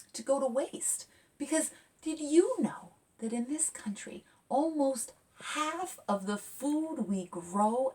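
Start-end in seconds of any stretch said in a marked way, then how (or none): 1.4-7.9 whispered did-you-know. Real, not acted. Author telling kids a shocking fact in a whisper. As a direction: hush it like a juicy secret.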